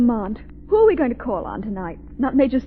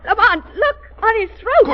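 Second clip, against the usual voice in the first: high, loud horrified exclamation; frightened cry; 8 semitones higher, 5 dB louder than usual. Acted. Margot showing Lamont the throat, horrified cry. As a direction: point it out in rising horror. Sees bat wound.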